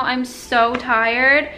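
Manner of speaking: usual speaking voice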